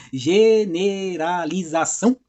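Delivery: very slow, drawn-out syllables; slow, deliberate sounding-out. Real, not acted. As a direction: stretch out each syllable after tripping on the word. Stumbles on word, then retries.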